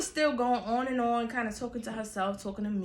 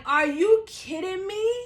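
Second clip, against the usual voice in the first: disbelief; 9 semitones higher, 5 dB louder, slower than usual. Retold or imitated. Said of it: "I'm like".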